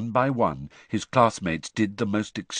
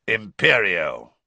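slow incantation; 2 semitones lower, 5 dB louder, slower than usual. Acted. Moody casts the curse.

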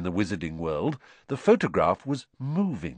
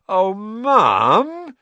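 higher, louder groaning complaint; whining protest; 12 semitones higher, 8 dB louder than usual. A narrator's reading. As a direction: son protests being sent to work, loud whine. Sons protest chore.

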